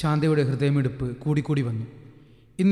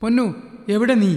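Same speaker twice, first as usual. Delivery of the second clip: mother calling across the house, louder and higher; 6 semitones higher, 4 dB louder than usual. Acted. Shantha calls out searching for Ponnu. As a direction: call out to find the child.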